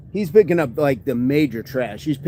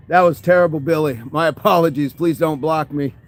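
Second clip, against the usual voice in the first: normal talking voice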